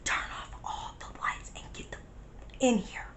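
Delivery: hushed, very soft whispered order; retold whisper. Friend ordering lights off, retold whisper. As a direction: whisper urgently as if someone could hear. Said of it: "it was like S M R the whole time".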